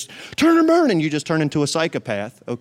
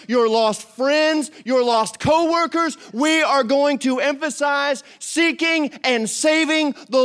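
rising preaching climax; 6 semitones higher, slower than usual. Real, not acted. Preacher builds to climax on the lost.

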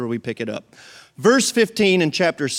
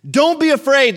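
prophet's call; 11 semitones higher, 7 dB louder than usual. Retold or imitated. Prophet reassures servant.

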